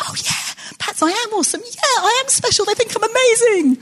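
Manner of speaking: high, preening mock self-satisfaction; smug. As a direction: puff yourself up, tongue in cheek. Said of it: "I was just like".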